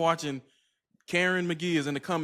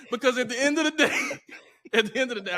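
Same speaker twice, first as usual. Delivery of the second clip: ordinary talk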